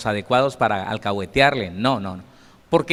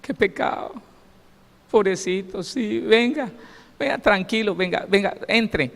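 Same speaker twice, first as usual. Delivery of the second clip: mock the overly kind humanist Jesus, sweetly; soft indulgent Jesus; 7 semitones higher than usual. Preacher mocks the humanists' idea of Jesus, higher and cooing. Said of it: "como dicen muchos humanistas".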